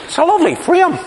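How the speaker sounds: high, naive admiring answer; wee Mark's voice. Retold or imitated.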